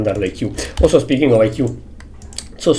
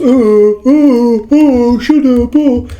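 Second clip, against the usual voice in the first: high, loud, slow panicky parent voice; mocking flustered parents; 11 semitones higher, 5 dB louder, much slower than usual. Mocking parents squealing at the dog, high and loud. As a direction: ham up the flailing parents shrieking at the dog.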